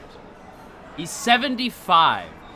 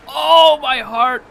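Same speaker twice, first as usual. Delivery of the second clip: much higher, louder dramatic gasp; mock heartbroken cry; 10 semitones higher, 12 dB louder, much slower than usual. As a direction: clutch your chest in mock pain.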